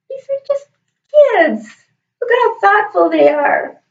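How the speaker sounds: real emotion, pitch jumping high; moved admiration. Real, not acted. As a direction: marvel at their kindness in a high voice. Moved by her students' letters.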